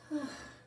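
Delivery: soft high sigh; mother's sigh. A narrator's reading. Mother sighing over her son's refusal. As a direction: let out a small tired sigh. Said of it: "Missus Quinn sighed".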